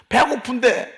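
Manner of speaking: high, loud mock wail of a hungry child; crying hungry child. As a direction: cry it out like a hungry baby. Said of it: "막 우는 거지". (배고파 우는 아이 흉내)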